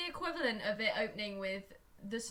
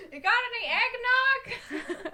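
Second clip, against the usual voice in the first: high, loud, slow character voice; hammed-up odd man off the street; mock stranger; 12 semitones higher, 6 dB louder than usual. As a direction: put on a silly drawn-out voice. Acts the replacement granddad.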